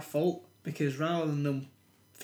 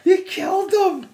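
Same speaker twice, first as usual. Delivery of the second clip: hysterical, very high shriek; drawn-out, hammed-up wail of horror; overacted scream; 10 dB louder, much slower than usual. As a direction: give it a hundred and ten percent. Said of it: "giving it fucking a hundred and ten percent".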